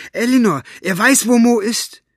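put-on high, loud child's call; excited shout. A narrator's reading. Maggie runs across the lawn to Elinor, calling excitedly. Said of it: "rief sie".

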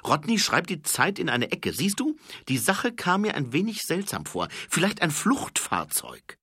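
put-on high, fast, keen young voice; eager high-voiced corporal reporting. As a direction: rattle off the report, high and quick. Corporal suggests getaway vehicle.

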